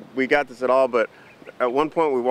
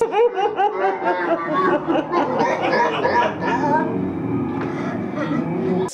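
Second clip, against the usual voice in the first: long high giggling; real laughter; 12 semitones higher than usual. Real, not acted. Describing the skinny clown, long real giggle.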